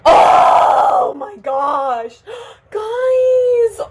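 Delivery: long, loud wail rising high; near-crying wail. Real, not acted. Vlogger cutting her hair to viewers, tearful wail. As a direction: draw each word out, on the verge of tears. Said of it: "please don't cry".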